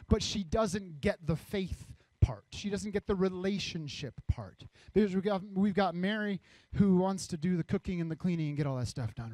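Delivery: ordinary delivery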